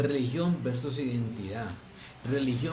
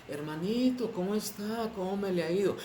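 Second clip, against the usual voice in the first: higher, sing-song fake-friendly greeting; mock saintly greeting; 7 semitones higher than usual. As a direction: put on a sugary pious voice for a fellow believer. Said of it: "Mire, cambian el tono de voz". (voz fingida más aguda de cristiano santurrón)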